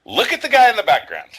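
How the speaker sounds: loud, high-pitched burst of amazement; excited exclamation. Real, not acted. Host shows co-host a man in the background, excited. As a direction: call it out as a big reveal. Directing friend to a man on screen.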